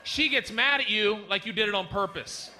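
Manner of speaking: ordinary delivery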